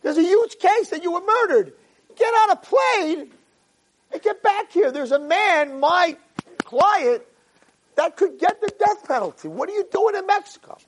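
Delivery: frantic lawyer yelling on phone. Retold or imitated. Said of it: "And he says".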